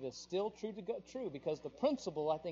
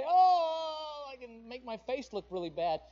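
mock wailing; 7 semitones higher, 7 dB louder than usual. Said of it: "I can say".

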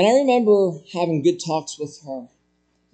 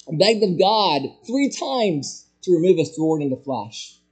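usual speaking voice

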